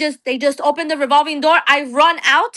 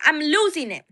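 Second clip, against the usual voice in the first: ordinary delivery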